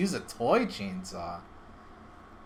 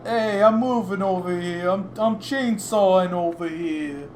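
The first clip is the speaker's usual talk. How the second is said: put-on New York accent; 4 semitones higher, 4 dB louder than usual. Streamer does a requested New York accent during a horror film, hammed-up. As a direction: put on a mock New York tough talk. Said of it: "Do a New York accent?".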